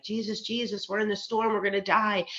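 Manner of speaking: imitating alarmed disciples; mock panic. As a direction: retell a frightened cry for help, half in earnest. Panicking disciples waking Jesus in storm.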